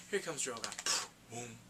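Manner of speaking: usual speaking voice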